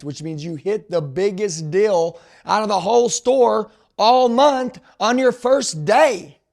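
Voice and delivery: slow, loud build to a high peak; retold big announcement. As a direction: draw out each phrase like announcing a jackpot. Manager dramatically announces a big bonus.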